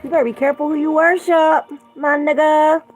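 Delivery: hammed-up street warning, fast and shrill-free loud; loud, high, playful threat; loud mock warning. Sudden warning to viewers.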